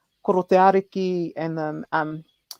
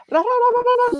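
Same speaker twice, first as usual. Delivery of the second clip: very high, loud imitated chant; Rose Pere's high call; 4 dB louder, faster than usual. Retelling Doctor Rose Pere on the pae, very high mock chant. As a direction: mimic a voice soaring way up out of reach. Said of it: "like five octaves high".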